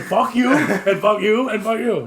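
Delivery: high, loud comic cursing; mock outburst. Acting out his reaction to friends turning round, mock-outraged. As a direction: playfully tell off each one in turn.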